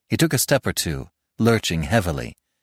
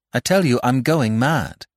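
higher, more forceful insistence; insistent repetition; 5 semitones higher than usual. Acted. Jack repeating himself to an unmoved doctor, pitched up and insistent. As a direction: push it harder to get a reaction. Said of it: "he repeated it".

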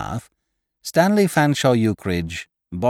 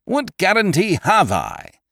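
higher, insistent demand; 11 semitones higher, 4 dB louder than usual. Acted. Eucridge demanding guarantees from the narrator. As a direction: press the question hard. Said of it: "demanded Eucridge".

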